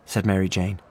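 usual speaking voice

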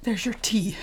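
barely whispered, cowed. Acted. Wife setting down tea for her drunk husband, whispered. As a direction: push the words out in a frightened whisper.

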